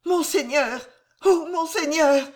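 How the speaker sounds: high, loud, stumbling plea; the words break out of her in fear; stammered in terror. Acted. Terrified mother stammers to the constable, high and loud. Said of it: "bégaya enfin Jeanne".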